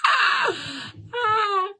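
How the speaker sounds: flustered high cries; embarrassed squeals. Real, not acted. Embarrassed squeal reading comments.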